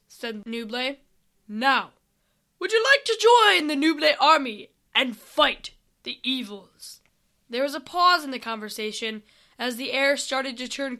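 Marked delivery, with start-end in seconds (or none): 1.5-7.0 loud, rousing recruitment call; army leader. Acted. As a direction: invite them in a commander's raised voice.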